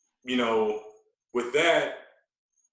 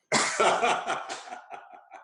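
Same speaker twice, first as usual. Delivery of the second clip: breathy laugh; 8 semitones higher than usual. Real, not acted. Laugh at ironic timing.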